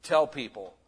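normal talking voice

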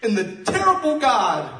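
high, loud impassioned cry; crying out to God. Retold or imitated. Preacher as Nehemiah seeing his people crying, crying out to God. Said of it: "he begins to cry out to his God".

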